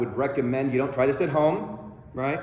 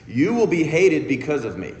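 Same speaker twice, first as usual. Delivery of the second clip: a touch of solemn scripture voice; quoting Jesus; 2 semitones higher, 5 dB louder than usual. Retold or imitated. Speaker quoting Jesus to the congregation, lightly solemn. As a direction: read it like a grave verse. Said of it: "in which he says".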